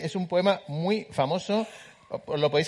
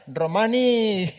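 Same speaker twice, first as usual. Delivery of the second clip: long drawn-out, raised Aragonese cry; Aragonese accent; 5 semitones higher, 3 dB louder, much slower than usual. Acted. Joking about a Roman from Calatayud, mock Aragonese accent. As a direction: put on a Calatayud accent, stretching it out slowly. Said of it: "uno de Calatayud que decía".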